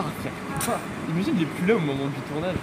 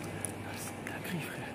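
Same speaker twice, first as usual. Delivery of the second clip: whispering; 13 dB quieter, slower than usual. Real, not acted. Whispered aside in car.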